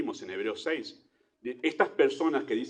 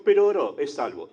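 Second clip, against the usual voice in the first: naive reassurance; 3 semitones higher, 7 dB louder than usual. Retold or imitated. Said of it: "y decimos".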